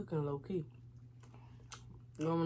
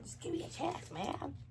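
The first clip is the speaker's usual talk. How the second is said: high pleading; 12 semitones higher than usual. Real, not acted. Pleading higher each time.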